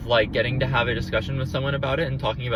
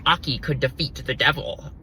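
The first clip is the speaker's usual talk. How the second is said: mocking quote. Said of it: "People say".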